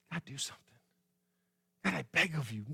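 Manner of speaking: hushed, begging prayer; pleading. Acted. Preacher acts out someone pleading with God to act, hushed pleading. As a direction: beg softly under strain. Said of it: "Have you ever pleaded with God to act?".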